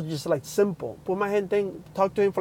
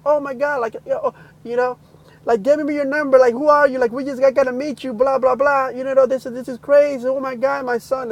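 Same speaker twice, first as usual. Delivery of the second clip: higher, louder, excited rush; retold excited mom; amazed mother; 7 semitones higher, 5 dB louder than usual. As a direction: gush on, overwhelmed with amazement. Retold amazed mother gushing on phone.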